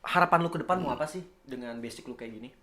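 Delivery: normal talking voice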